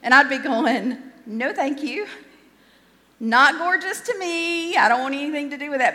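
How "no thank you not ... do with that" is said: high-pitched comic recoil, rattling faster at the end; mock refusal. Preacher reacting to an ugly crocodile, hammed-up refusal. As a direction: back away from it in a hammed-up high voice.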